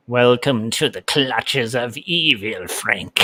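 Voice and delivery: lightly put-on demon, a little slow; a mild sinister welcome. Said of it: "I'm enjoying the demon voice".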